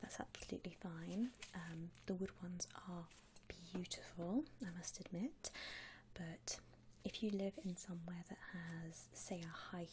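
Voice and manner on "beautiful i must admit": ordinary talk, nothing marked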